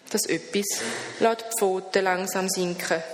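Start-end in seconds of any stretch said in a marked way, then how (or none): none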